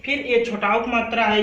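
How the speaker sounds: ordinary talk, nothing marked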